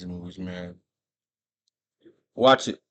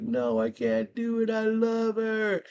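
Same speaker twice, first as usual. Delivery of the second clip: anguished refusal; 14 semitones higher, slower than usual. Retold or imitated. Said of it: "And Anton's like".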